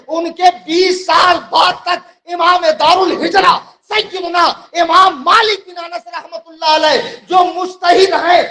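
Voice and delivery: high, loud oratory driven to a climax; fiery preaching. Real, not acted. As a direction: argue it hard to a big crowd, ringing out. Preaches at full force. (जोशीली, बुलंद आवाज़ में तक़रीर)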